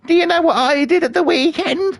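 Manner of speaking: high, put-on chatty old man voice; high mock old man gossiping. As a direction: play the nattering old bloke at the till. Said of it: "he's sort of like".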